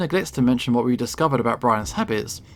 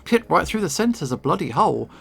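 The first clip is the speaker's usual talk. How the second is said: usual speaking voice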